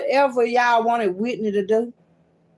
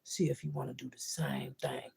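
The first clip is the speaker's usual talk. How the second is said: softer, hushed, menacing whisper; warning whisper; 8 semitones lower, 16 dB quieter than usual. Real, not acted. To enemies, a hushed threatening whisper.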